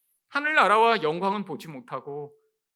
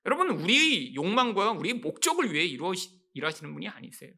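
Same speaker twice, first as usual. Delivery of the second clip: ordinary delivery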